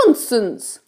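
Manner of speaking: high-pitched storybook ladybird voice; kindly ladybird. A narrator's reading. Ladybird explains things to James, kindly high voice. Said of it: "said the ladybird".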